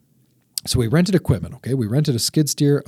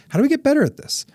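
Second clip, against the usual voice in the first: much higher, lifted rhetorical question; mock earnest question; 3 dB louder, faster than usual. Owner asking himself how the company improves, high mock-earnest. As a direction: pitch the question way up, half joking. Quoting own inner question.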